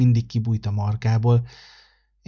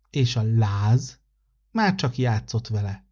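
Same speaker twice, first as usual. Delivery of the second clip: usual speaking voice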